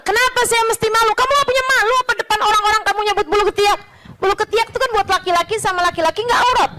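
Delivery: high, very fast indignant defense; defiant retort. Retold or imitated. The sheikh defending himself to the accuser, fast and defiant. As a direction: fire it back, unashamed.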